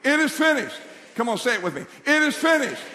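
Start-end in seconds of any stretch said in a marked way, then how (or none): 0.0-0.8 high, loud rallying declaration. Shown as a demonstration. Preacher leading congregation to say it together, declaring. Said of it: "we need to speak it out loud". 2.0-2.8 high, loud rallying declaration. Shown as a demonstration. Preacher leading congregation to say it together, declaring. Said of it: "we need to speak it out loud".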